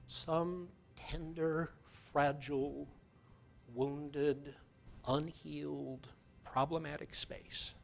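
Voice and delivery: slow, soft meditation voice with long pauses; guided meditation. Acted. Speaker guiding listeners to a tender spot, slow meditation voice. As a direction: ease them gently inward, unhurried.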